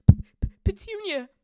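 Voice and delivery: stammering, high, loud gasp; horrified, choking outburst. A narrator's reading. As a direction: stutter the first sound in shock. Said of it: "he gasped".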